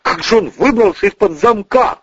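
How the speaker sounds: high-pitched incredulous question; alarmed bewilderment. Acted. Bonifatsky questions how the prisoner escaped, bewildered. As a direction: ask as if it is impossible.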